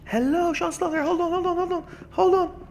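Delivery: high, loud cartoonish greeting; silly high voice. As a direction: squeal it like a cartoon. Greeting game character in funny voice.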